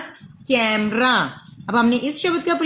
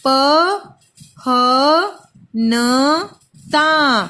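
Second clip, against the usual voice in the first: syllable by syllable; 3 dB louder, much slower than usual. Shown as a demonstration. Teacher breaking पहनता into syllables for pupils. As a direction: split पहनता into slow separate syllables. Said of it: "अब इसको बोल बोल कर देखो".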